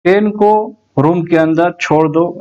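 ordinary delivery

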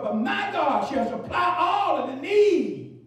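ordinary talk

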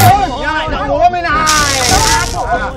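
loud, drawn-out yell; angry yelling. Acted. Protector yells at the man taunting him, angry yelling. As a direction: holler it out over the scuffle.

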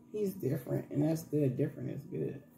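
hushed, fading to a whisper; soft aside. Real, not acted. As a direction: say it tenderly under breath. Soft aside about son.

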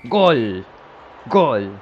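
loud goal call. Real, not acted. Own goal by keeper.

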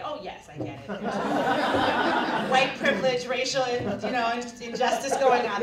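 mock officer's confession, loud and laughing; laughing mockery. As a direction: laugh through a sarcastic made-up reply. Mock quote of the officer's reply.

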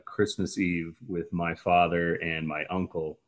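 ordinary delivery

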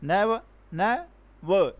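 "Never, never" slowly, the second broken into two syllables; "never" sounded out syllable by syllable; split into syllables. Shown as a demonstration. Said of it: "a word which have two syllable".

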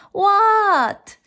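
high, loud, drawn-out reply; calling back. A narrator's reading. Froggy answering his mother's call, loud and high. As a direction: holler back in a high child's voice.

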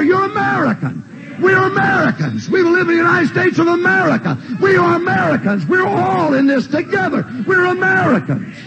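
rally the congregation, full voice; preaching at full force. Acted.

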